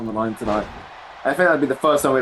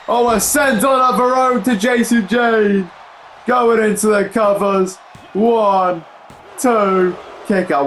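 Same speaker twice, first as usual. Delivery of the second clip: excited call; 7 semitones higher, slower than usual. Real, not acted. Live call of a top-rope move.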